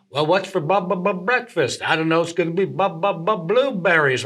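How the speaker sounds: light crooner impression with bubbling stammer. Sketch cast all imitating their guest, lightly voiced. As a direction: bounce on each bu-bu before the word. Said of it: "was kinda talking like [name]".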